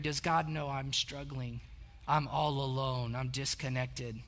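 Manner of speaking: low, soft inner voice of someone struggling; lonely, discouraged. Acted. Struggling believer wondering alone in the cave, lonely and hushed.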